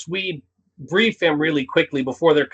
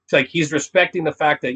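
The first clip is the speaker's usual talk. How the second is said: ordinary delivery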